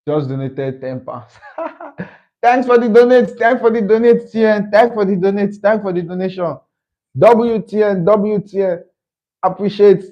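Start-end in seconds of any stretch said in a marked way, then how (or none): 1.4-2.1 soft, high giggle; delighted laugh. Real, not acted. Delighted by a donation. 2.4-10.0 high-pitched, gushing gratitude; excited thanks. Real, not acted.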